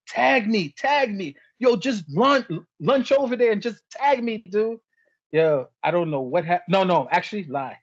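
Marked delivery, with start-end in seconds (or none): 0.1-4.8 imitating Hogan. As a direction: beg your partner for the tag, pitched up. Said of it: "Hogan is like".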